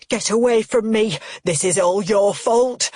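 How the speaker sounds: put-on angry old man yelling; furious. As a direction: lash out at her. Father lashes out at Cheryl.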